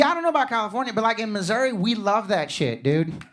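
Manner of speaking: usual speaking voice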